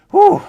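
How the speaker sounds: sharp, loud high exhale; loud relieved whew. Real, not acted. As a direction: blow out the breath loudly. Exhale after long sentence.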